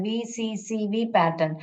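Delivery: ordinary talk, nothing marked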